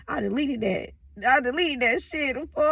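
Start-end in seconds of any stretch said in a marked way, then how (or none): 1.3-2.7 higher, playful put-on sass; hammed-up, cracking yourself up. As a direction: say it with mock sass, breaking into a laugh. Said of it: "I like talking like that".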